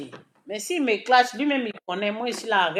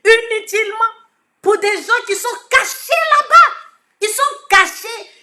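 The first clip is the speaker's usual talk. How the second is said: indignant shout; 11 semitones higher, 8 dB louder than usual. Real, not acted. Scolding a party rival.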